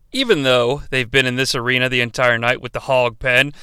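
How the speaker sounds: usual speaking voice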